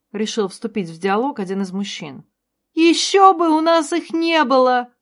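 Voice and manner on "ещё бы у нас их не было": high, loud, slow tearful cry; tearful shout. A narrator's reading. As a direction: shout through tears. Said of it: "прокричала Зайна со слезами на глазах". (крик сквозь слёзы)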